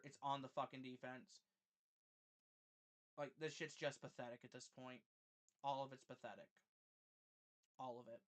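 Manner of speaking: low, soft, drained resignation; deflated. Real, not acted. Streamer ending the stream after a loss, deflated. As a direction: let the anger sink into quiet defeat. Beaten fan gives up on stream.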